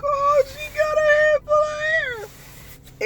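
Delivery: very high, loud and slow delight; gushing in awe. Retold or imitated. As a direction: squeal over the newborn's hair. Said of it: "I was like".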